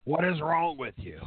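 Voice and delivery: hammed-up imitation of an affronted boss; Vince McMahon. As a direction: start understanding, then turn on them in disgust. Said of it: "that's exactly what Vince McMahon would do".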